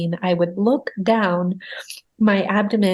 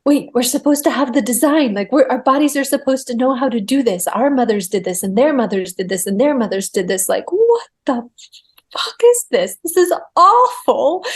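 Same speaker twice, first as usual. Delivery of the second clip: higher, louder outraged disbelief; exasperated inner protest; 4 semitones higher, 4 dB louder than usual. Retold or imitated. Labouring mother protesting to herself about the pain, exasperated. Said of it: "thinking to myself".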